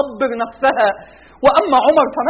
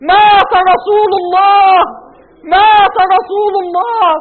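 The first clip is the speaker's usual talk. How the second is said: slow, louder grieving cry; 5 semitones higher, 5 dB louder, slower than usual. Acted. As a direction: wail the news to the crowd. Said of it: "صرخت فيهم أقول". (صرخة حزينة بطيئة عالية)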